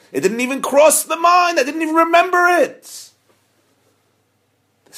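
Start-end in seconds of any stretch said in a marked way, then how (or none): 0.1-3.1 high, loud outburst; exclaimed admission. Acted. Rabbi admitting he forgot his coat, loud and high. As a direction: burst out with it.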